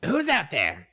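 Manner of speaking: high rat voice; lightly voiced rat challenge; wary rat. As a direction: call out into the dark as a wary rat. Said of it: "it is enough to say".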